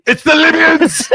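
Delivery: very high, slow alarm cry; hammed-up scream of warning, stretched out; panicked movie shout. Host quoting Back to the Future's warning, mock-panicked shout.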